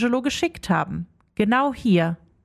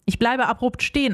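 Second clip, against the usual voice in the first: plain reading voice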